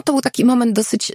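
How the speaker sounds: normal talking voice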